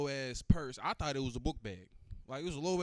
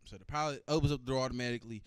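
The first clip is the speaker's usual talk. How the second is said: ordinary talk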